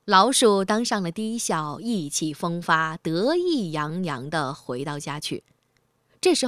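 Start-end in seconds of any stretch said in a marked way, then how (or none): none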